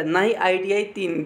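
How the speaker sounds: ordinary talk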